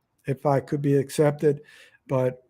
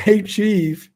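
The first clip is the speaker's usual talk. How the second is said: achieve said higher and louder through a laugh; 5 semitones higher, 6 dB louder than usual. Shown as a demonstration. Vlogger laughing at his own word play, says achieve with a laugh. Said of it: "That pretty much describes me".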